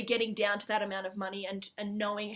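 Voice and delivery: ordinary delivery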